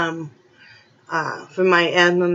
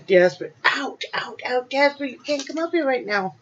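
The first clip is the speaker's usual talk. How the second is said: pet-talk voice; 6 semitones higher than usual. Real, not acted. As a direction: coax the cat away gently. Shooing cat from counter.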